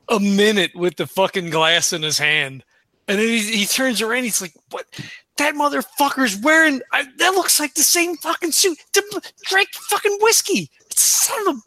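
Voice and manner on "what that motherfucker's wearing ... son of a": rising, incredulous mock outrage, climbing higher; getting worked up. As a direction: sputter in disbelief at the copycat. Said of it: "he's getting worked up".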